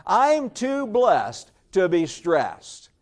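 slow, emphatic, with conviction; like you mean it. Shown as a demonstration. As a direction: say it slowly and firmly, meaning every word. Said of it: "Say it like you mean it".

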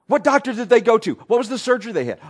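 high, loud, pushy questioning; a busybody grilling the widow; nosy know-it-all. Retold or imitated. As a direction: badger the grieving one with questions. Nosy mourner keeps grilling.

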